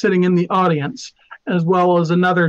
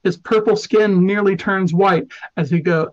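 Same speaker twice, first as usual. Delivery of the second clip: usual speaking voice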